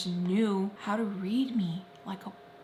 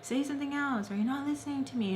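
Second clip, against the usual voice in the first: higher-pitched, insistent mock scolding; imitated impatient demand, raised pitch; agitated; 6 semitones higher, faster than usual. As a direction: voice an agitated person pushing for an answer. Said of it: "The person becomes very agitated".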